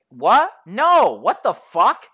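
loud, high startled "what"; retold incredulous outburst. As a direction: recoil in disbelief, voice jumping up. Startled refusal after being woken.

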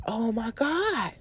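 softly awed, drawn-out exclamation; hushed mock astonishment; retold amazement. Retells her reaction to the discovery.

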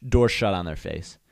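ordinary delivery

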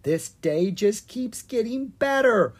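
put-on high, slow boy's voice, delighted; a gleeful young dragon boy; Owen's voice. Owen's voice in read story.

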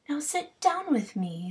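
a hint of a smitten queen; lightly voiced fairy queen. Titania voiced.

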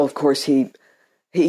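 ordinary delivery

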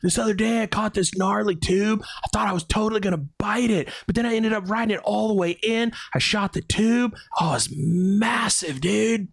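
hammed-up stoked surfer bro; mock California surfer. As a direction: play a totally Californian dude. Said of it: "And I'm like".

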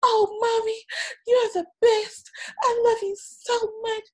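put-on high, bright child voice gushing at mommy; excited little boy. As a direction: play the delighted little son, voice up high. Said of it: "He was like".

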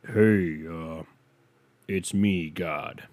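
lightly voiced deep God; casual God announcing himself in a throaty voice; a hint of a low, throaty deity. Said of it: "throaty voice".

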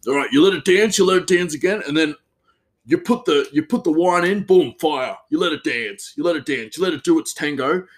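a hint of a pumped-up head chef; imitating the hyped chef. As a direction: cheer the pans on like a showy cook. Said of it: "That's what he always says".

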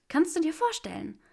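thrilled to show her results; enthusiastic. A narrator's reading. Granger showing Draco her results, enthusiastic and high. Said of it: "erklärte sie enthusiastisch".

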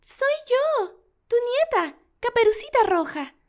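put-on very high, sweet child voice; little girl voice. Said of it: "contestó".

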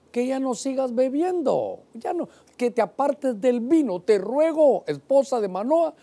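God urging the wife, high pleading voice. Acted. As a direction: beg her gently but urgently. God's voice pleading.

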